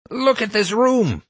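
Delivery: very high, drawn-out outraged shriek; high-pitched, indignant screech; enraged shriek. A narrator's reading. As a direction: shriek it like a furious principal. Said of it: "the principal shrieked".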